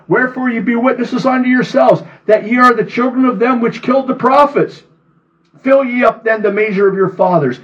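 high, ringing sermon voice; fervent scripture reading. Acted. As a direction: preach it at full force. Reads scripture with preaching force.

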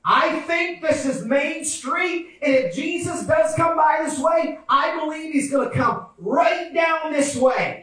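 high, insistent preacher voice; eager expectancy. Acted. Blind man expecting Jesus down this street, high eager conviction. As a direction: reason it out with fervent hope.

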